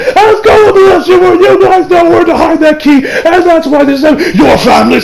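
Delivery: high, shouted anime villain tirade; unhinged mock raving; ranting up a storm. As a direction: rant it in hammed-up fury, voice climbing. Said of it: "the man's ranting up a storm".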